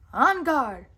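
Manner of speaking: loud ringing challenge; battle cry. A narrator's reading. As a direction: call out to the guards.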